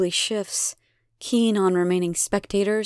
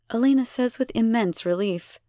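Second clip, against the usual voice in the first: usual speaking voice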